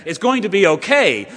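preacher ringing out good news; rousing proclamation. Acted. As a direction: proclaim it like dawning light.

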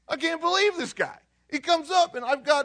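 high, loud, amazed storytelling; high-pitched, hammed-up excitement; excited, thrilled. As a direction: act out a man bursting with his news. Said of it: "but he's excited. He's thrilled".

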